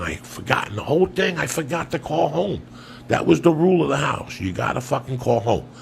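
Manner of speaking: normal talking voice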